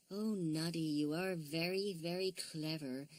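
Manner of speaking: soft, slow admiring bear; quiet slow admiring praise; admiring, softly. A narrator's reading. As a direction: look up at him in wonder, hushed. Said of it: "with such big round eyes".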